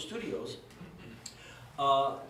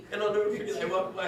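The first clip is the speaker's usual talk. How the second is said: usual speaking voice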